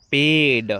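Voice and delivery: पेड drawn out loud with an "ay" vowel; correct vowel. Shown as a demonstration. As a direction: say पेड slowly as "payd", rhyming with made. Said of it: "पेड पढ़ना है".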